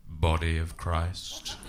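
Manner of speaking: very low, slow, solemn priest; deep ceremonial communion voice. Retold or imitated. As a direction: intone it gravely, drawing out each word. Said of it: "Came up to me, the priest".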